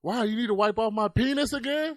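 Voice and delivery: put-on high, loud little boy yelling; mock kid shouting; loud child voice. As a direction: yell it like a small kid in a store. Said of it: "He was like".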